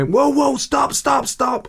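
high, frantic cries to stop; a beaten man yelping for it to stop; panicked plea. Retold or imitated. Opponent pleading after one punch, high and frantic.

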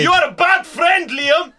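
high, loud and slow; hammed-up boss shout; mock shout. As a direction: yell it at the employee. Improv quarrel shouting.

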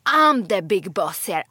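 loud, slow, chest-thumping brag in English; mock chef boasting. The chef beating his chest at the hotel guests, loud slow mock boast. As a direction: puff up like a pompous Italian chef claiming he's boss. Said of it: "Då slår han kocken sig på bröstet så här".

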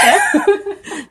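high, loud delighted laugh; burst of laughter. Real, not acted. Host laughing with the cake maker over chocolate. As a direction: let out a bright laugh.

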